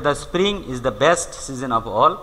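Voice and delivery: slow, deliberate reading of the example. Shown as a demonstration. Teacher reading an example to students, slowly. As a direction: spell it out slowly for the class. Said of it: "teacher reads example slowly".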